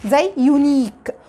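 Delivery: "يونيك" said slowly with a long yoo at the start; slow word demo. Shown as a demonstration. Teacher gives students an example word, slowly. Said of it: "بنطقه بصوته اليو اللونج".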